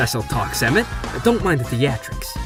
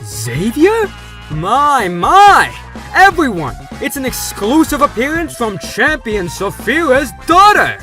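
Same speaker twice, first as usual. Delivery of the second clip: milk the big reveal; excited host; 6 dB louder, slower than usual. Acted. Host discovers champion's daughter.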